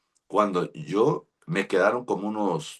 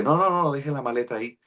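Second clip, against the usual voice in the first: alarmed protest; 8 semitones higher, faster than usual. Retold or imitated. Fears stranger will steal suitcase.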